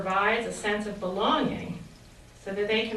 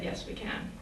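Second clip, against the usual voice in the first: whisper; 11 dB quieter than usual. Retold or imitated. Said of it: "And he said".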